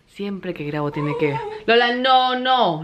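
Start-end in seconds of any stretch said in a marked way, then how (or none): 1.7-2.8 sharp call to the dog; raised, higher scolding call. Real, not acted.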